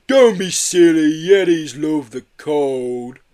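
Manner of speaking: loud, slow, drawn-out yeti voice; big tipsy creature brushing off the worry; amused yeti. A narrator's reading. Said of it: "chuckled Marbo".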